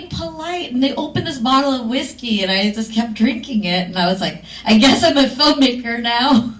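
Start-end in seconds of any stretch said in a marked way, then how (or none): 4.7-6.5 loud, raised voice breaking with laughter; laughing acceptance. Retold or imitated. Whiskey-drinking new director accepting her fate, laughing. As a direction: retell a giddy, what-the-heck surrender.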